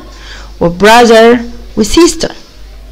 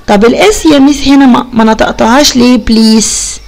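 usual speaking voice

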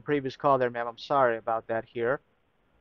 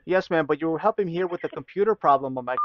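usual speaking voice

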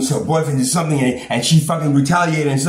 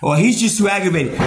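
ordinary delivery

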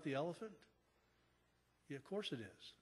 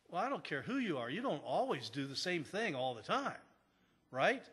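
ordinary talk

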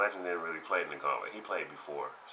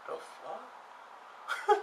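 stunned crowd; 4 dB quieter than usual. Retold or imitated.

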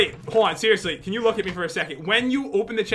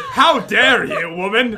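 loud, slow mock indignation; hammed-up offence, words drawn out; mock outrage; 6 dB louder, slower than usual. Gamer scolding partner over refused gift, mock outrage.

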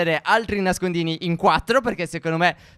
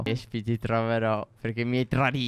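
menacing villain; 8 semitones lower, 6 dB quieter than usual. Pretend. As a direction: promise revenge darkly. Seeker vows revenge.

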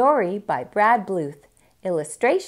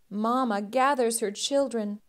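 ordinary delivery

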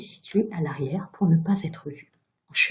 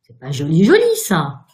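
scolding; 8 dB louder than usual. A narrator's reading. Virginia lecturing the ghost about his wife, scolding. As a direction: lecture him like a naughty child. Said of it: "Sermonna-t-elle, plus précisément".

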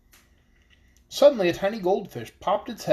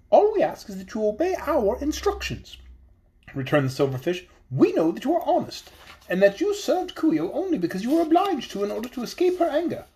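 high, small voice of a wise little fish; high fairy-tale fish voice, earnest; magical fish voice; 4 semitones higher than usual. Acted. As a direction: speak up as a tiny enchanted fish, solemn and hopeful. Silverfish gives instructions.

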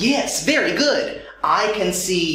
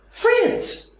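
high call; 11 semitones higher than usual. Acted. Calling to children.

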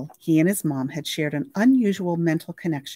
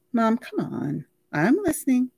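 high, pleading lines; a lightly voiced son and mother; voiced dialogue; 7 semitones higher than usual. A narrator's reading. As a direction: coax with a lifted voice. Boy and mother on phone.

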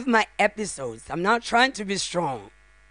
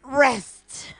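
building from louder speech to a higher sobbing cry; crying out in grief; 6 semitones higher, 7 dB louder, much slower than usual. Real, not acted. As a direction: let it break into a grieving cry. Mother's final goodbye at son's funeral.

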